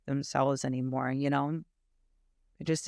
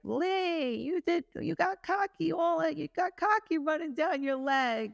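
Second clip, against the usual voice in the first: much higher, playful mock dad voice; hammed-up silly dad teasing his daughter; imitating her dad; 12 semitones higher, slower than usual. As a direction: tease the little girl in a high teasing voice. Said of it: "And he was like".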